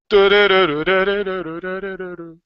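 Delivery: rapid run of "du-du-du"; imitated sound effect. Host following a dramatic comment, imitated fading effect. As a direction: rattle off a long, fading "du-du-du".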